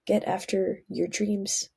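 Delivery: ordinary talk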